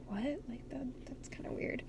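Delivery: quiet, breathy whisper of disbelief; whispered aside. Real, not acted. Telling viewers the coincidence felt weird, whispered. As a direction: drop to a whisper, half to yourself.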